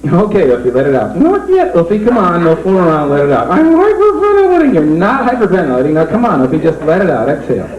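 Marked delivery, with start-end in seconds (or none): 1.1-1.7 high, pressed puppet voice; puppet holding breath. Acted. Rabbit puppet refusing to exhale, high and pressed. 3.5-4.7 high, louder puppet gasp; panicky puppet. Acted. Rabbit puppet panicking while holding the smoke, high.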